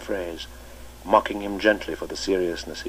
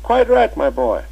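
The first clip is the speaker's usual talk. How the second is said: put-on much higher and louder, playful old schoolmaster; twinkling old master; 5 dB louder than usual. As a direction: cap their joke with a merry invitation to tea. Chips teasing with twinkling eyes.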